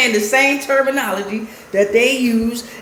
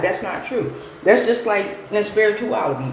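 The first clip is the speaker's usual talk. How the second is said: ordinary talk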